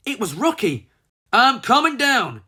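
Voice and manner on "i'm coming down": loud high shout. A narrator's reading. Rocky shouting down into the silo, loud and high. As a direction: call it out bravely. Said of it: "he shouted".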